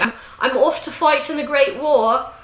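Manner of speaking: mock British soldier's send-off; British accent. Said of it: "I don't know why I did British".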